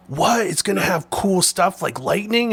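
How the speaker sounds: put-on high, loud disbelieving voice of a small boy; amazed kid. Nephew learns light leaks make streaks, amazed. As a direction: sound wide-eyed and thrilled.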